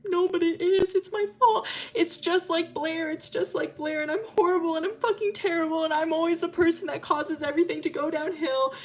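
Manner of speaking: high, wailing self-blame; acted breakdown, pouring it out in a high whine; sobbing. Milo blaming himself while Arden comforts him, acted sobbing. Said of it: "just break out in sobs".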